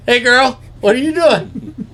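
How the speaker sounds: high, loud coo to a deer; imitating dad flirting with game; sweet-talking. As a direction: coo it way up high, near laughing. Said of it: "Talk sweet to him".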